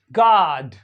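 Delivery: गॉड as "gaad", long open unrounded AH; American open O. Shown as a demonstration. Teacher demonstrating American गॉड to students, long open AH. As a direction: open the vowel of गॉड like the first vowel of father. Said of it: "इस तरह से".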